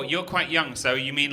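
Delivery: ordinary talk, nothing marked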